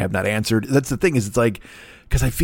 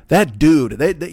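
usual speaking voice

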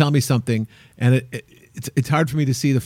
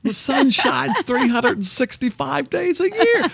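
slow, drawn-out sportscaster impression, climbing much higher; Harry Carey; 8 semitones higher, slower than usual. Host quoting a comedian's joke about the sun, Harry Carey impression. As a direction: stretch the words like an old-school ballgame announcer, pitch soaring. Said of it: "doing a Harry Carey impression".

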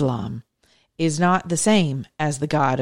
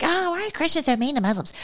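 high, fast, put-on whiny voice of a critic; mock whiny critic; 10 semitones higher, 3 dB quieter, faster than usual. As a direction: squeak it out like a complainer mocking Christians. Mocking critics' whiny question.

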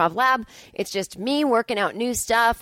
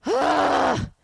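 frustrated groan; 7 semitones higher than usual. Real, not acted. Venting about PMS, exasperated groan. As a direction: let out an exasperated ugh. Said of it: "Ugh, P M S".

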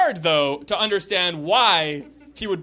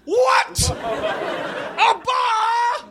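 high shouted Jamaican cabbie; Jamaican accent; 10 semitones higher, slower than usual. Acted. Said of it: "admiring my Jamaican accent".

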